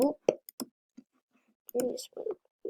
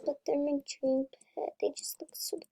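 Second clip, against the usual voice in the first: whisper; 4 semitones higher, 7 dB quieter than usual. Real, not acted. Whispering about dream pet.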